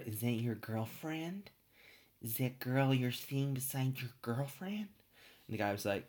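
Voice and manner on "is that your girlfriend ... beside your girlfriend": slow, drawn-out camp showman impression; botched impression sliding into a lingering showman purr. Creepy actor asking about a girlfriend, slow put-on showman voice. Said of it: "turning automatically into [name] there".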